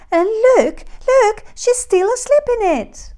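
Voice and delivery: very high, loud, excited cub squeal; squeaky little bear. A narrator's reading. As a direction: squeal out the discovery as Baby Bear. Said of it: "squeaked the very small bear".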